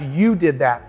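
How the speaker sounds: "You did that." dropped low, with a falling close; low falling statement on "You did that."; credit handed back. Retold or imitated. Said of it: "I said".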